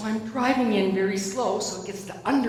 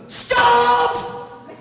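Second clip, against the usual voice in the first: full-volume high yell of one word; imitated screaming; 9 dB louder than usual. Storyteller as Dad screaming signs at a nervous driver, high shout. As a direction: scream it out like Dad reading a sign. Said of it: "he's screaming".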